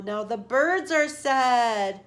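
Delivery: usual speaking voice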